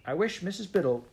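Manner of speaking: high, urgent wish; sudden cry. A narrator's reading. Anthea suddenly wishes the angry woman good-tempered, urgent cry. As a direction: blurt it out, pitched right up. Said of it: "cried Anthea suddenly".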